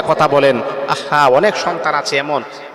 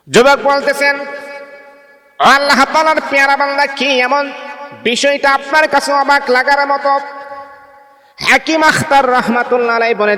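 high, ringing sermon chant; fervent waz preaching, pitched high and loud; impassioned preaching; 11 semitones higher, 4 dB louder than usual. Acted. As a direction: lift the voice and call out to the crowd. Preacher voices the youth's question.

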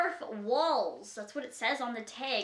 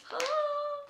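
high gasp; 13 semitones higher than usual. Real, not acted. Seeing case inside.

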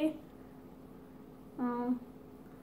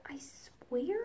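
whispering; 8 dB quieter than usual. Real, not acted. Whispered warning to the cat.

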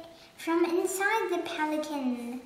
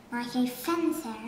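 usual speaking voice

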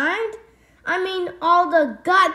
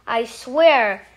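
ordinary delivery

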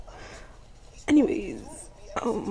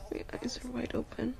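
whisper; 8 dB quieter than usual. Real, not acted. Teasing partner about sleepiness.

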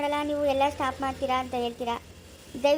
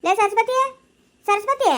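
loud, higher call; 8 semitones higher, 7 dB louder, slower than usual. Acted. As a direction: yell for Saraswati. Calling out to Saraswati. (ಸರಸ್ವತಿಯನ್ನು ಜೋರಾಗಿ ಕೂಗುವುದು)

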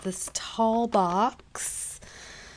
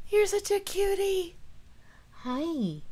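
much higher sing-song voice to the cat; 12 semitones higher than usual. Real, not acted. Cooing at cat.

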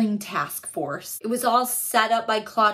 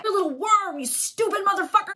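high, fast sneering snarl; mock angry insult; 9 semitones higher, faster than usual. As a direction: spit the insult out, hammed up. Villain insulting the detective.

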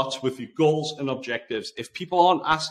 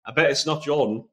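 ordinary talk

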